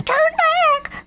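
extremely high-pitched cartoon crow cry; shouting crow. A narrator's reading. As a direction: cry the warning in a tiny, very high voice. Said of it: "shouted Lucy Crow".

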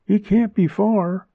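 high-pitched voice for a woman character; lifted, high female-character voice; woman character's voice. A narrator's reading. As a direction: pitch her well above the narration. Same woman character, calling back.

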